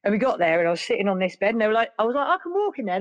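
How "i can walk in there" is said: high-pitched protest. Retold or imitated. Her own protest at the hospital.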